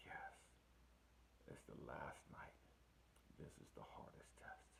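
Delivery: breathless, unvoiced whisper of someone at the end of their strength; faint whisper. To his spiritual guide, weak and crying on the last night, whispering. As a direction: barely get the words out, worn down.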